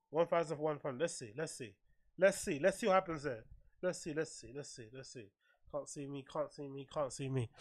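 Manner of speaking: very soft, low repeated mumble; muttering to self. Real, not acted. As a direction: murmur it under your breath while busy. Muttering to self while adjusting settings.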